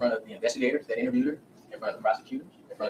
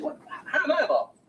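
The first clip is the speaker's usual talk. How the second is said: shocked disbelief; 11 semitones higher, slower than usual. Retold or imitated. Woman shocked by police accusation.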